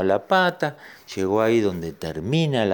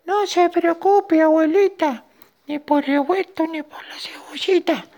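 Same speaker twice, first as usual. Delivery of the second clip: tiny ant voice. Acted. Ant telling grandma not to worry, tiny reassuring squeak. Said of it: "le dijo la hormiga".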